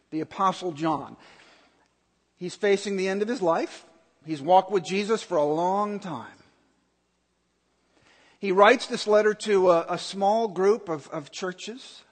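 normal talking voice

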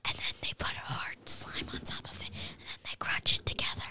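low ASMR-style whisper. Real, not acted. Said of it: "we're whispering into the mics".

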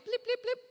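high, loud little blips; imitated eye drops. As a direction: make each drop a high-pitched blip.

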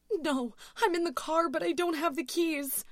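high, loud, desperate voice; panicked cry. A narrator's reading. Louise trapped in the car without keys, panicked. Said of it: "screamed into her phone".

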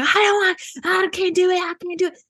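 hammed-up terrified whine, fading at the end; mock panic. Mocking Lauren refusing the harness, high panicked wail. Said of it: "freezing to death".

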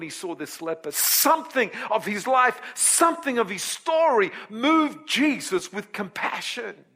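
high, loud, ringing sermon voice; full-force preaching. Acted. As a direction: preach it out to the whole congregation. Preacher telling Simon's story.

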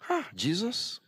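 quietly incredulous question; a listener's hushed disbelief; hushed sceptical gasp. Retold or imitated. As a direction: sound amazed under your breath. Mock sceptical listener reacts.

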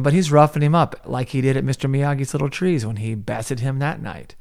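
ordinary delivery

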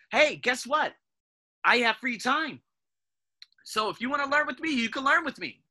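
high, loud, eager sales pitch to a student; cheerful announcement to a student; upbeat pitch. Acted. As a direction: sell the free time brightly. Pitching free time to student.

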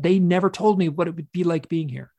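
worked-up protest. Retold or imitated. Hypnosis client re-enacting protest about the beings, anguished and loud. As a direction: re-enact being overwrought and wronged. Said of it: "I got worked up and worked up".

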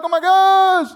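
high, hammed-up panicked gasp; mock panic. Preacher imitating a panicking believer, mock panic. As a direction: mimic a believer freaking out. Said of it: "whenever you're like".

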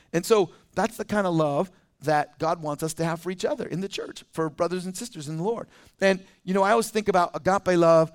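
normal talking voice

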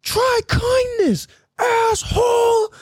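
very high, slow hammed-up yell; mock shrill insult. The line cutter yelling at the narrator while driving off, slow high mock yell. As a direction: drag out her parting jab. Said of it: "she goes".

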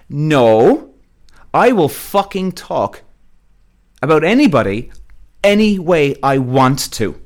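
slow, emphatic, forceful pushback; defiant retort. Retold or imitated. Man telling his date he will talk how he wants, defiant. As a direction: stand your ground, hitting each phrase hard.

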